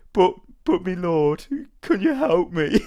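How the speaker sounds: high, halting, drawn-out peasant voice; pleading peasant. Acted. Villager begs the Witcher for help, pleading peasant voice. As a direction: plead like a helpless, childlike villager.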